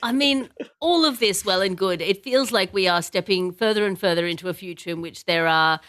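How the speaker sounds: ordinary talk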